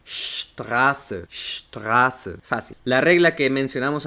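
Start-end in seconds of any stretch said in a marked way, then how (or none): 0.0-2.3 "Straße" begun with a long sht; SHTRAH-se, carefully for learners; pronunciación alemana. Shown as a demonstration. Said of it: "como la palabra calle". ("Straße" en alemán, con sh inicial)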